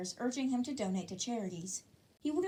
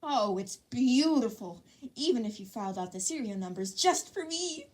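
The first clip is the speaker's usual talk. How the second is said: gloating; 5 dB louder than usual. A narrator's reading. Midoriya admires the gun.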